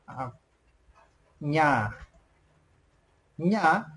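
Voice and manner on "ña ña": "ña" with a palatal ny sound; Quechua pronunciation. Shown as a demonstration. Teacher models a letter missing in English, Quechua pronunciation. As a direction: say "ña" as nya, clearly separated. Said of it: "you pronounce in Quechua".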